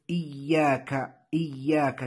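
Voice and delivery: "إياك" with a held, doubled y. Shown as a demonstration. Teacher points out the letters to learners, "إياك" with doubled y. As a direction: slowly press and hold the ya in "إياك". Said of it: "والياء مشددة".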